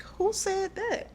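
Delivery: high, slow, incredulous question; startled disbelief, reenacted. Reacting to hearing God's voice, startled and high-pitched. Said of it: "I kinda was like".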